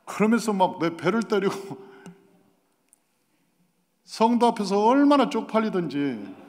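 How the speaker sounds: normal talking voice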